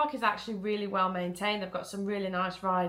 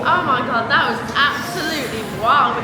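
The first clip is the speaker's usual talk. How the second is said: loud, buzzing after a coaster; gushing with excitement, louder; thrilled and breathless; 4 semitones higher, 10 dB louder than usual. Real, not acted. Just off the night coaster ride.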